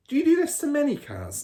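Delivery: put-on a high, curious boy's voice; a little boy asking his dad; eager boy. A narrator's reading.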